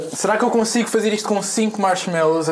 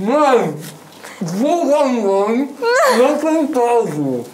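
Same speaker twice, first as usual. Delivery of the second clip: cheeks stuffed full; 5 semitones higher, much slower than usual. Real, not acted. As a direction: mouth crammed with marshmallows. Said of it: "Mãe, jogo online não tem pausa".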